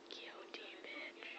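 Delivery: slow hushed whisper. Real, not acted. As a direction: whisper it under your breath. Muttered insult to the dog.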